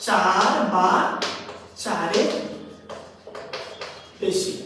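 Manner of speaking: long pauses within "চার বা চারের বেশি"; slow dictation. Shown as a demonstration. Teacher dictating a definition, "চার বা চারের বেশি" slowly spaced.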